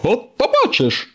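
high, taunting tease; teasing. Acted. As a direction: needle your rival in a high voice. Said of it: "дразнився сирник". (дражливий високий голос)

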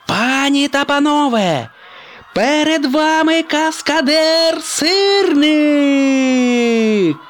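high, loud, slow drawn-out showman call; announcer presenting the stunt act to a stadium crowd. Acted. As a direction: sell it to the crowd like a ringmaster. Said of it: "оголосив ведучий".

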